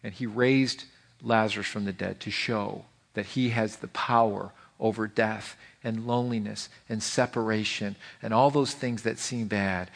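normal talking voice

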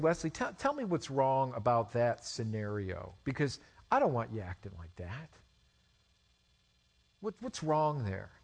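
low, soft voice of a dad; hushed, serious question to a son; quiet fatherly talk. Retold or imitated. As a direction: take the child aside, low and gentle. Father talking gently to son.

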